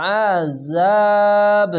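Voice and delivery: আযাব held very long and loud, with a bounce on the final ব; echoing qalqalah. Shown as a demonstration. Teacher demonstrating the qalqalah echo on আযাব. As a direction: stretch আযাব, then bounce the ব. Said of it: "ধাক্কা মেরে নিচে sound আসবে".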